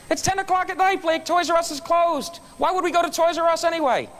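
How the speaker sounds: playing his own baffled self; incredulous dad.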